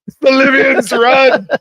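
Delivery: high-pitched hammed-up alarm; panicked movie shout. Host replaying the Libyans warning, mock-panicked shout. Quoting Back to the Future scene.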